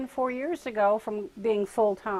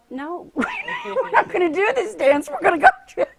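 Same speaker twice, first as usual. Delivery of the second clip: louder, higher, slow laughing no; laughing refusal; 9 semitones higher, 9 dB louder than usual. Retold or imitated. Guides refusing the dance.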